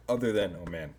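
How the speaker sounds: talking through hiccups; words broken by a hiccup. Real, not acted. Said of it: "I think I'm hi-, hiccuping".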